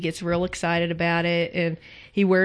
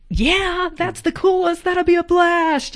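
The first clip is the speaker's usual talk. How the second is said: put-on much higher, gushing teen squeal; excited teens; 11 semitones higher, 3 dB louder, slower than usual. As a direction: squeal it like thrilled teenagers. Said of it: "those two teenagers are like".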